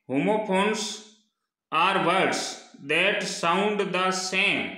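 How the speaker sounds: reading the definition out word by word, slowly; careful, drawn-out classroom reading; slow dictation reading. Shown as a demonstration. Teacher reads definition to class.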